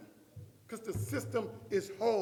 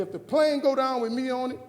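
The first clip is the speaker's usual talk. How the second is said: retold argument, higher and loud; loud declaration; 5 semitones higher, 9 dB louder than usual. Husband setting his wife a condition, loud and emphatic. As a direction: announce it loudly to the wife.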